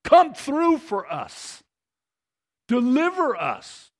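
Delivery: slow, loud, high plea for rescue; pleading cry. Acted. Preacher voicing the crowd pleading with God, loud and high. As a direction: beg God to come through, slowly. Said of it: "they were pleading with God".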